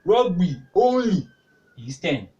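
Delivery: slow down and lean hard on "only"; stress on only. Shown as a demonstration. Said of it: "Look at the way I said this".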